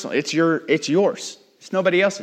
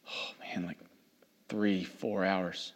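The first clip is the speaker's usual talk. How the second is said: whispered, much lower, pitiful sigh of a voice; mock weary whisper; 7 semitones lower, 13 dB quieter, slower than usual. Mocking a dramatic college student, whispered and pitiful. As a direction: play the drama of suffering, barely voiced.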